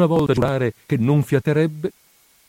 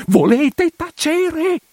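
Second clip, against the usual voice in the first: far higher and louder, slow, near a squeal; shrieking hush; 4 dB louder, much slower than usual. Acted. Priest shrieking at his housekeeper to stop talking. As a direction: cut her off at the top of his voice. Interrupts her once more.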